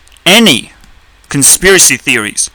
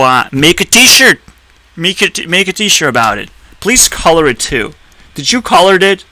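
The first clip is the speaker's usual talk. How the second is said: normal talking voice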